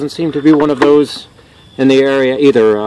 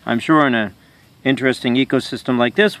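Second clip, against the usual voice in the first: usual speaking voice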